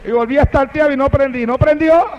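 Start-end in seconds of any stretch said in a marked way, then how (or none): 1.4-2.0 rising, puzzled "¿No prendió?" ending high; surprised question. Acted. Narrator wondering why the car won't start, "¿No prendió?" rising. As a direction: ask "¿No prendió?" in disbelief, rising at the end.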